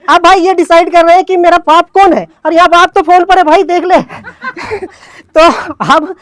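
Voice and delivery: high, loud, breaking into laughter; can't hold back the giggles; laughing while talking. Real, not acted. Laughing at the absurd scene.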